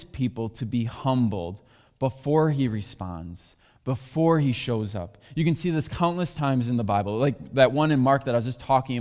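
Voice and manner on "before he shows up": normal talking voice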